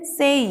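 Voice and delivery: सेई with only a light ee at the end; lighter glide. Shown as a demonstration. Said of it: "पूरा ज़्यादा ई भी नहीं लेना".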